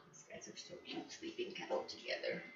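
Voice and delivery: hushed whisper so the puppies sleep on. Real, not acted. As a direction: breathe the words, no voice at all.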